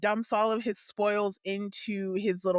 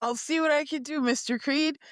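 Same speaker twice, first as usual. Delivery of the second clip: put-on quick, higher, keen young voice; eager young Garen; 6 semitones higher, faster than usual. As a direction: rattle it off eagerly to the shopkeeper. Player voices Garen answering Creed.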